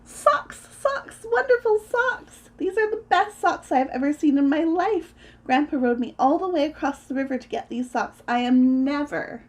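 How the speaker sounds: put-on high, loud kid's delight; thrilled, high-pitched exclaiming; excited yelling. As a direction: crow with joy over a treasure. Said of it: "ran home and yelled".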